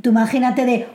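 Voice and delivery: usual speaking voice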